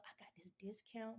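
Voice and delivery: whispered secret discount offer. Retold or imitated. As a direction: lean in and whisper it. Confiding a discount.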